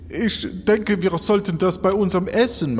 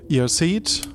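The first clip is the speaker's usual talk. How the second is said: ordinary talk, nothing marked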